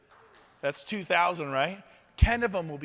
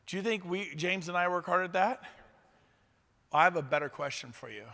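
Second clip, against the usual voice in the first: ordinary delivery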